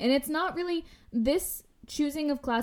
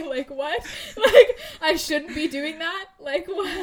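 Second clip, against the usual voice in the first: laughing disbelief; 7 semitones higher, 9 dB louder, slower than usual. Real, not acted. Incredulous laughing at the idea.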